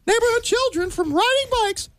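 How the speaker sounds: cartoon mouse reading the headlines; Mickey Mouse. Guest reads a silly local headline to hosts, as a cartoon mouse. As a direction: put on a cartoon mouse newsreader voice. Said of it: "That sounds like Mickey Mouse".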